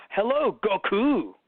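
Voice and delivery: slow, stiff formal Hello, Goku; formal greeting. Retold or imitated. Dub actor greeting Goku, slow stiff formal. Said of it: "It'd be like".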